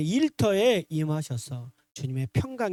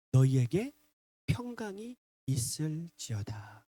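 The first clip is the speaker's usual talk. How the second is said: soft, slow and low blessing; gentle blessing; 4 semitones lower, 4 dB quieter, slower than usual. Retold or imitated. Jesus' first words to his frightened disciples, gentle blessing. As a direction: speak peace gently, drawing it out. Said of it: "제일 처음에 하신 말이 뭐예요?".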